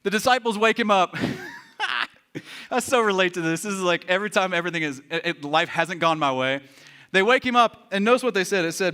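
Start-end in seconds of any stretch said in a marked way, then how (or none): none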